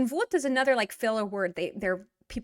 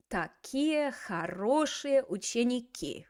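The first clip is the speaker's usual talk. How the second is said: slow model phrase; 3 semitones higher, slower than usual. Shown as a demonstration. Teacher models phrase slowly.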